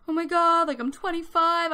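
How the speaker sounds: playful birthday freak-out; mock crisis. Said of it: "like, a crisis".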